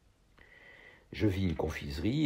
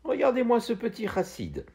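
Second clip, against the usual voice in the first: much higher, a girl's mocking cry; mocking girl's exclamation. A narrator's reading. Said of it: "Une jeune fille s'exclama". (jeune fille qui s'exclame, moqueuse, d'une voix bien plus aiguë)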